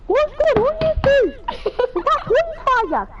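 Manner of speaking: shrieking high and loud, laughing at the end; high startled dad. Retold or imitated. Daddy Pig finding the kids on fire, high shriek. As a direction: yelp it way up high in panic. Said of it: "Daddy Pig is like".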